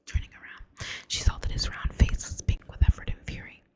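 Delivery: ordinary delivery, nothing marked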